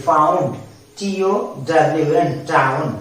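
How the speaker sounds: normal talking voice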